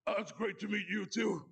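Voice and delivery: a light impression of a raspy-voiced older sister; a hint of a worn-out cartoon aunt; Marge Simpson's sister voice. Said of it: "in this Marge Simpson sister voice".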